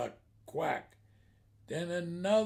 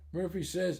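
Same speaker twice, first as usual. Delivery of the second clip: ordinary talk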